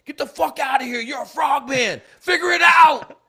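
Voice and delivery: loud, high dismissive bellow, building; yelling officer. Retold or imitated. Warrant officer throwing the new guy out, yelling. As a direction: yell it, peaking on the last order. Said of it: "He's just yelling at me".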